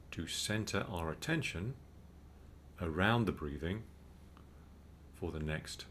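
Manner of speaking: calm, unhurried meditation guide; measured mindfulness instruction with spacious pauses; guided meditation. Real, not acted. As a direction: guide listeners gently into breathing, with long pauses.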